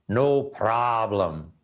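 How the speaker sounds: put-on slow, thick Russian accent. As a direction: drawl it with a heavy Russian accent. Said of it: "a very thick Russian accent".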